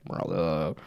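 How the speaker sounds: low, loud groaning zombie moan. Pretend. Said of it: "there's some audio".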